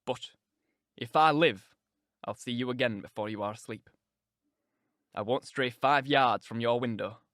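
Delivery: low, earnest man's voice; Heathcliff. Acted. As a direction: promise it low and deliberately. Heathcliff promising Catherine to return.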